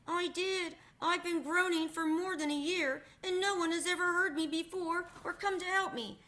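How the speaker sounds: mournful metal man, voice lifted; lightly voiced Tin Man. A narrator's reading.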